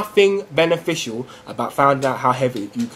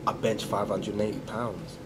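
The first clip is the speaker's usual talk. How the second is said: low, hushed show-off voice; low-key bragging; 7 semitones lower, 12 dB quieter than usual. Acted. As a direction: brag softly and deep to impress a girl. Acted boast to a girl.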